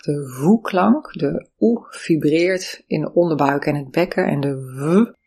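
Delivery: usual speaking voice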